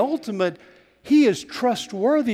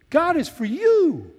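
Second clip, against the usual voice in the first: "you" lifted high, then falling; stress on you; 9 semitones higher than usual. Shown as a demonstration. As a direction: land the emphasis on final "you". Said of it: "let's put the emphasis on that last word".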